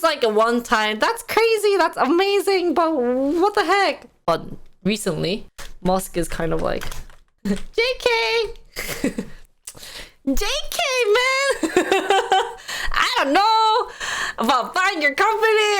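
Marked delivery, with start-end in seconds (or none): 1.0-4.1 mock amazement. Twitter's reaction acted out. 7.8-15.7 much higher, giggly mock take-back; Musk backing out. Musk laughing off the Twitter deal, high mocking voice.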